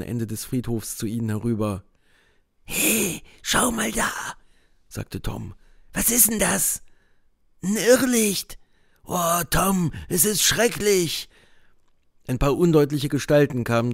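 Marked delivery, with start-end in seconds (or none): 2.6-4.1 put-on higher, eager boyish voice; a boy pointing something out; boy's voice. A narrator's reading. Tom's voice in dialogue. 5.9-11.3 put-on higher boy's voice, scared; a boy spooked by a light; frightened boy. A narrator's reading. Boys voiced higher in dialogue.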